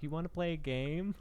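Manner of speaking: put-on soft, lilting invitation from a little kid; a hint of a child asking to play; little girl. Voicing little girl's invitation to villains.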